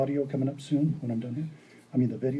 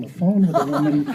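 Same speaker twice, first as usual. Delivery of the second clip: laughing. Real, not acted. Laughing at own story.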